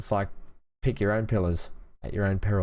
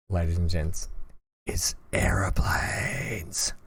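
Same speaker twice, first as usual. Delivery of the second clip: whisper; 2 semitones lower, slower than usual. Acted. Dramatic reveal of today's plan.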